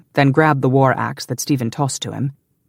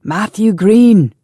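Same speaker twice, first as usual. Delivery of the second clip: loud, high, drawn-out call; shouting up; 8 semitones higher, 10 dB louder, much slower than usual. A narrator's reading. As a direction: holler the name up a high wall. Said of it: "Stephen shouted up".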